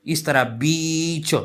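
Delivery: बीच said slowly with a stretched long ee; long ee demo. Shown as a demonstration. Teacher modelling the long vowel for students, slow and drawn out. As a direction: draw out the ee in बीच. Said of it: "long ई के तौर पर".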